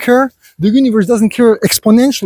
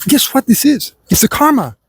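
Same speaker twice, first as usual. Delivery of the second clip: lightly voiced lecturing elder, higher and pointed; preachy lecture to son; 4 semitones higher than usual. Lecturing a complaining son about karma, mildly stern. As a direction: scold the meat-eaters like a stern father, a little higher. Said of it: "I'm like".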